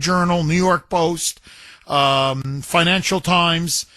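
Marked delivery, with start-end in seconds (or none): none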